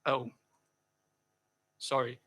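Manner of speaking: ordinary talk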